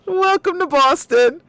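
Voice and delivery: put-on loud high Boston accent greeting; hammed-up Boston welcome; mock Boston accent. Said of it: "New York accent".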